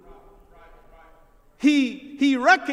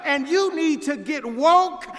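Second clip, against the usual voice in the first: raised, emphatic pulpit voice; forceful preaching; 3 semitones higher, 3 dB louder than usual. Real, not acted. Preacher telling the congregation God has not moved, forceful preaching. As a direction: drive each phrase home with sustained force.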